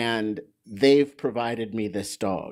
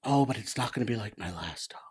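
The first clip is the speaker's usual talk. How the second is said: quiet doubtful aside; 2 semitones lower, 7 dB quieter, faster than usual. Retold or imitated. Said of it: "you think".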